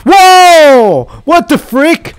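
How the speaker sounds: high loud yelp of shock; startled shout. Real, not acted. Gamer surprised by a new entity, startled shout. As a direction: caught off guard by a creature.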